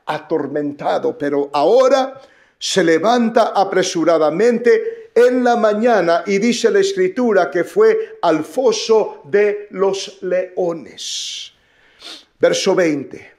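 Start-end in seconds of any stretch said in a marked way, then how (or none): none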